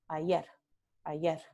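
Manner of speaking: ayer ending in a devoiced r; soft, breathless final r in ayer; voiceless final r. Shown as a demonstration. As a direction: Mexican final r. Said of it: "en lugar de ayer".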